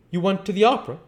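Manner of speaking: ordinary delivery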